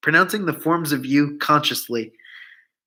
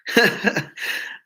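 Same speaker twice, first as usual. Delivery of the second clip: a quick breathy laugh, higher up; short laugh; 6 semitones higher than usual. A narrator's reading. Dixon laughing at what he takes for a joke, breathy laugh. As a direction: let a short airy laugh out, falling away. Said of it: "Dixon laughs".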